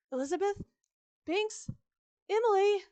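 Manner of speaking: high, loud searching calls; frantic calling out. A narrator's reading. Narrator searching for vanished ghosts, calling out frantically. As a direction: call each name into the distance. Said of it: "I call out to the chilly night air".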